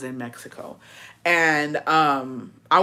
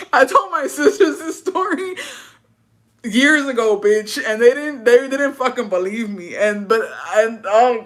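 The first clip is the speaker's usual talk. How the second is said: laughing while talking; 5 semitones higher, 4 dB louder than usual. Real, not acted.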